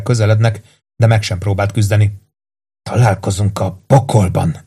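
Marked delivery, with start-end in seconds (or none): 2.8-4.6 dark, weakened farewell; grim parting threat. A narrator's reading. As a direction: force it out as a beaten man's last words. Beaten victim's last words to killers.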